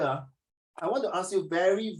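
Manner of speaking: ordinary talk, nothing marked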